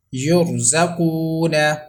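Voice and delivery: Yurzaquna read slowly and clearly; slow recitation. Shown as a demonstration. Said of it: "read without spell".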